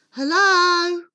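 high, loud drawn-out hello. Acted.

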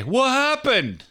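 high, slow drawn-out what happened; mocking whine. Host mocking the book title, high and drawn out. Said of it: "I say".

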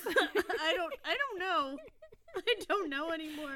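loud, high, helpless laughter in the words; real giggling fluster; flustered laughing. Real, not acted. Flustered, laughing over her questions.

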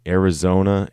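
ordinary talk

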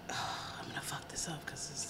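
hushed unvoiced aside; whispered aside before a French word; whispering. Real, not acted. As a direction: whisper it nervously.

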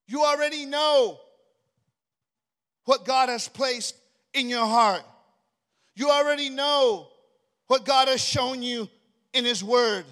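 loud, forceful preaching. Acted. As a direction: preach it hard to the congregation.

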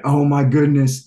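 high, loud alarmed exclamation; alarmed gasp. Retold or imitated. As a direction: blurt it out in sudden alarm. Retold alarm at announcement.

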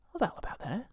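hushed whisper, lost in thought. Acted. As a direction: whisper it like pondering the year. Whispered reflective question.

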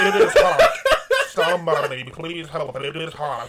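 high, loud, laughing cry for help; mock plea for help. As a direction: beg for rescue while cracking up. Mock plea from burning house.

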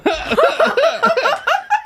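shrieking delighted laugh; big real laugh; loud high burst of laughter. Real, not acted.

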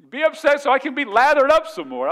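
high, loud, hammed-up demand; mock exasperation. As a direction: play a worked-up spouse itching to argue. Acted spouse wanting a fight.

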